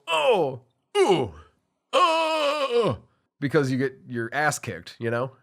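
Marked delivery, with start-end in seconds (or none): 0.1-3.0 high yelps of getting hit; mock pained oh sounds; pained grunts. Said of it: "They just go".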